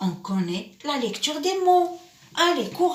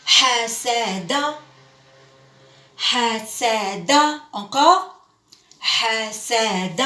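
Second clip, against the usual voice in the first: slow syllables; slower than usual. Shown as a demonstration. Teacher drilling حسد slowly with the class. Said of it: "teacher models reading slowly".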